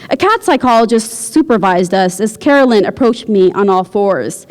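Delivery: ordinary delivery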